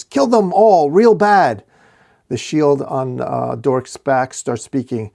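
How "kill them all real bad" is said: high, loud orc war cry; yelling. A narrator's reading. Orc urging the others on, yelling. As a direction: bellow the order to attack. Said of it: "Another orc yells".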